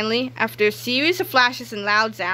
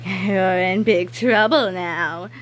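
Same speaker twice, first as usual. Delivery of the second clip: gloating chuckle; 2 semitones lower, much slower than usual. Acted. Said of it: "chuckled Miss Anthrope".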